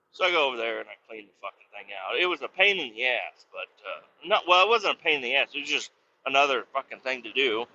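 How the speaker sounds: ordinary delivery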